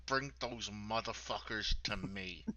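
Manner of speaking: slow, menacing through gritted teeth. Shown as a demonstration. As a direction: growl it through clenched teeth. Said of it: "gritted teeth".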